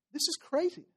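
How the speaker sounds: high, softened mock incredulity; hushed, high-pitched bafflement, acted; acted disbelief. Crowd scoffing at Jesus' claim.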